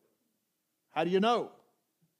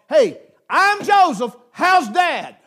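loud, slow, higher, cheerful hey; loud casual greeting; 6 semitones higher, 9 dB louder, slower than usual. Acted. Preacher voicing Joseph greeting his brothers, loud and cheery. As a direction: call it out like a happy reunion.

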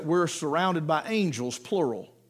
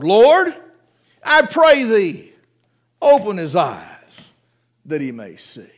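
fervent prayer; 7 dB louder, slower than usual. Acted. Elisha's urgent prayer, voiced.

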